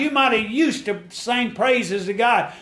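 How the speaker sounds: climbing gospel preacher cadence; preaching at full force. Acted. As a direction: lift the congregation up. Preacher exhorts congregation.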